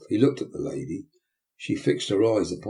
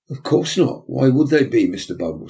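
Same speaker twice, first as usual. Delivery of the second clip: higher, louder indignant lady; indignant matron; 4 semitones higher, 6 dB louder than usual. Acted. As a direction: protest sharply as the matron.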